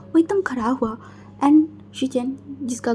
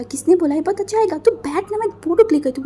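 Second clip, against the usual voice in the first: higher, louder teasing push; playful insistence; 6 semitones higher, 4 dB louder, faster than usual. Acted. Shijan insisting to Wing on a photo, higher playful tone. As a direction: cheerfully overrule him.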